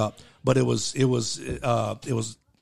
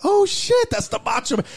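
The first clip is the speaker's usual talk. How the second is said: high-pitched shocked exclaim. Retold or imitated. Friend spots Macho Man's listing, shocked squeak. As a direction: jump way up in disbelief.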